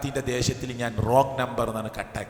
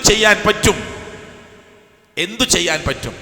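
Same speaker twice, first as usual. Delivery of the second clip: louder, higher preacher's cry; impassioned cry; 7 semitones higher, 9 dB louder than usual. Acted. Preacher pressing the congregation on what they can do, crying out. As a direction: hurl the question at the crowd. Preacher's urgent rhetorical question.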